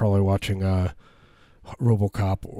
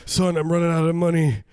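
higher, pleading mock-dad complaint; exasperated dad; 7 semitones higher, 4 dB louder than usual. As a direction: protest to your kid that you can't keep paying. Acts exasperated dad to son.

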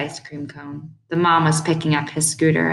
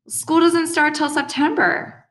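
high, drawn-out objection, louder at the start; boy's protest; 11 semitones higher, 4 dB louder, slower than usual. A narrator's reading. Boy objecting to his mother's plan, high protest. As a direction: protest it slowly in a high kid voice.

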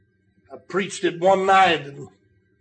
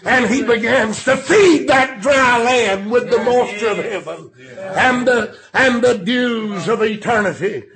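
full-force preaching. Real, not acted. Preacher urging the congregation through life's storms, full-force preaching. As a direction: build to a shouted peak, then ease off.

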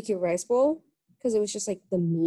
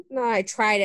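normal talking voice

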